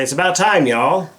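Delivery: playful Southern y'all; Southern drawl. Shown as a demonstration. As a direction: lean into a Texas twang on y'all. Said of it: "I can speak American / And I can say".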